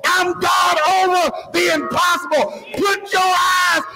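high-pitched, sustained pulpit cry; fervent preaching. Real, not acted. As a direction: preach it hard, voice up high throughout. Preacher at full force.

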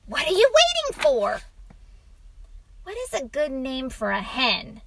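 put-on high-pitched little-boy voice; eager boy. A narrator's reading. Boy prods father at storytime, eager. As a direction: push the story along, quick at first.

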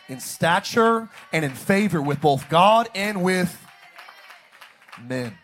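loud, rising sermon peak; preaching climax. Real, not acted. Pastor declaring to the church, loud rising. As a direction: lift the crowd with it.

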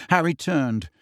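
usual speaking voice

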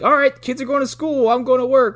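high, loud cheery dad voice; chipper husband. Retold or imitated. Husband announcing his day to his wife, overly cheery and loud. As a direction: sing it out like a happy sitcom husband. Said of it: "he's like".

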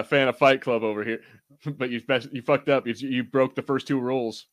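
ordinary talk

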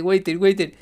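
high, loud broken English. Retold or imitated. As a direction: blurt out clumsy English orders.

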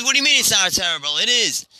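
mildly incredulous pushback; indignant protest. Acted. Manager objects to consolation.

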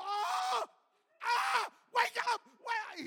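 high, very loud re-enacted panic; frantic yells at full volume; panicked shouting. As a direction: scream to rouse someone who has fainted. Panicking as the kid passes out.

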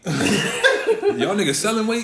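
high, slow, laughing put-on voice; laughing mock question. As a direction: ham it up through laughter. Joking buyer voice, laughing.

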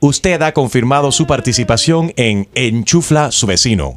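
steady automated recorded-message voice; phone menu voice. Acted. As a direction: read it like a robotic phone menu.

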